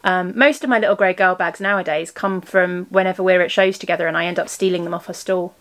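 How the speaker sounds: usual speaking voice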